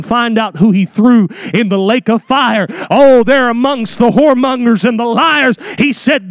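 ordinary delivery

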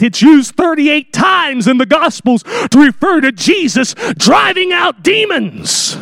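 rising preacher shout, slowing on demons; preaching emphasis. Real, not acted. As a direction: drive it home from the pulpit.

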